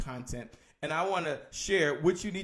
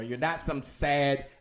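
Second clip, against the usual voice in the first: ordinary talk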